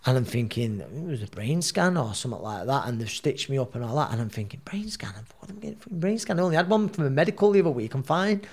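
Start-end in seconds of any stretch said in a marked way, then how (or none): 4.6-8.4 high, disbelieving thoughts, tumbling out quickly; incredulous inner voice. Retold or imitated. Boxer in hospital, dismissing brain scan, high and incredulous. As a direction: scoff at the idea of a scan.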